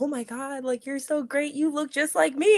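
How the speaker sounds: gushing fan. Retold or imitated. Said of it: "And I'm like".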